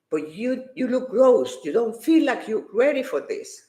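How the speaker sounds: ordinary delivery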